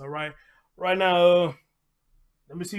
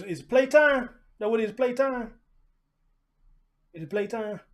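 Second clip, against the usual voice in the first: higher, louder sing-song playtime question; playful puppy talk; 6 semitones higher, 3 dB louder than usual. Real, not acted. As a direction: tease the dog excitedly about play.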